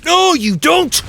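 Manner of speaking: high, loud taunt at the enemy; gleeful no-you-don't shout. Real, not acted. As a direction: yell it at the screen.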